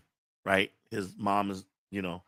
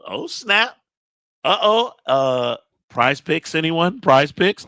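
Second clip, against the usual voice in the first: high, loud excited calling; 8 semitones higher, 9 dB louder, slower than usual. Real, not acted. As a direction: hype it up loud. Excited chat reaction.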